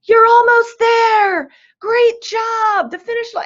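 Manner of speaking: high, loud cheering for a tired runner; cheering crowd. Retold or imitated. Spectators at mile twenty cheering marathon runners, high excited shout. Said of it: "are screaming things like".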